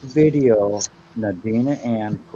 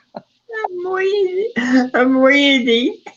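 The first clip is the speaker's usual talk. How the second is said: silly high voice; much slower than usual. Pretend. Teasing reading of typed word.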